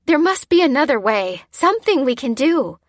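high, loud, urgent appeal; pleading. A narrator's reading. Lucy stalling for time, pleading. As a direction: plead for another way. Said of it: "pleaded Lucy, stalling for time".

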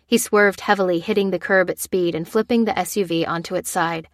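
ordinary delivery

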